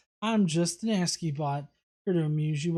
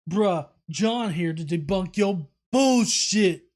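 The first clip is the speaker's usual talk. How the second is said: slow, louder, mock tough guy delivery; 6 dB louder, slower than usual. Host reads the comment as a slow, louder swaggering bro. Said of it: "'Cause again".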